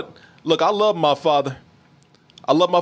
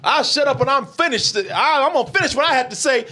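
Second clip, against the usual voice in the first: high-pitched, worked-up backtalk; defiant teen retort; 5 semitones higher than usual. Retold or imitated. Son talking back to his father, defiant. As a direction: argue back hard, pitch riding high.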